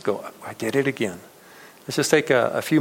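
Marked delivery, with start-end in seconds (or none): 0.5-1.2 softer, a touch of weepy regret; mild mock lament at your own failing; rueful sigh. Rueful and softer, confessing a repeated habit to the congregation. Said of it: "we just weep".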